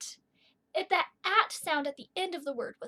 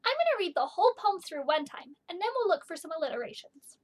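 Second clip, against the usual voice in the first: usual speaking voice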